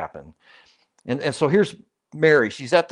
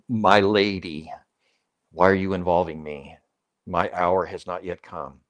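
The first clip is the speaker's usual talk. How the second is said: respectful; 5 semitones lower than usual. Shown as a demonstration. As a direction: speak kindly, with deference. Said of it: "Jesus is being respectful here".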